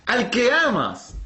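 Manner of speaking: high, slow divine proclamation; God insisting. Acted. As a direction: stretch it out as God narrowing the choice. Acts God insisting to Abraham.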